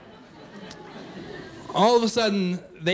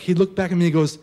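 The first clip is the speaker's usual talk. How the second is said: ordinary talk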